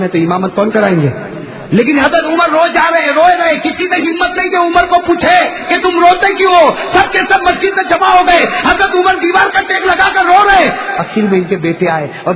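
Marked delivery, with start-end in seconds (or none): 1.7-10.7 high, loud sermon shout; impassioned preacher at a high pitch; full-force preaching. Acted. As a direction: thunder it out to the crowd.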